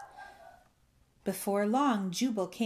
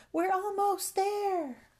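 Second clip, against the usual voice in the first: high, drawn-out call; anxious call; 12 semitones higher, 3 dB louder, slower than usual. A narrator's reading. Rescuer nearing the bullfrog in the storm, anxious calling. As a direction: holler slowly across the distance, pitched up. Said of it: "an anxious voice called".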